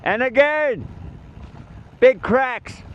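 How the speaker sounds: calling out a warning. Real, not acted. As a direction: shout the hazard back down the line. Calling road hazards to riders behind.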